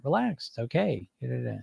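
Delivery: soft soothing hush; quiet and reassuring, trailing off; calming them down. Retold or imitated. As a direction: settle a panicked client gently. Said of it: "I'm calming them down".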